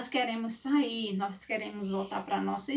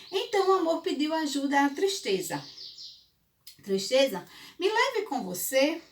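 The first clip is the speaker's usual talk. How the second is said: put-on storytelling to children; 3 semitones higher than usual. A narrator's reading. Fable narration continues.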